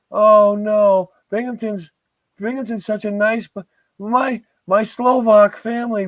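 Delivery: high, mocking sing-song voice of a local; mocking Binghamton defender. Mocking a Binghamton defender in high, prissy voice. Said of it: "anybody that tries to tell you that".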